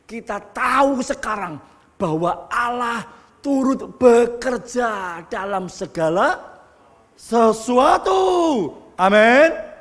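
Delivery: high, loud climax shouted to the hall; preaching at full force. Real, not acted. Preacher proclaiming God's sovereignty to the congregation, shouting and rising.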